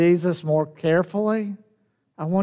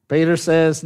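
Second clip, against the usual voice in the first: ordinary talk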